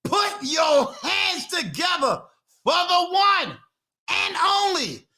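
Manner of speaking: big, high showman announcer call for the guest; announcer hype intro. Acted. Host bringing on the guest to the audience, hyped announcer voice.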